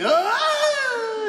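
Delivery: high yell starting too big; too-big burst. Shown as a demonstration. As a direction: blow it all at the start, then crash. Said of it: "if you go too big right at the start".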